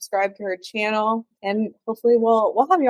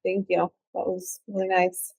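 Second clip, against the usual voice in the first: ordinary talk, nothing marked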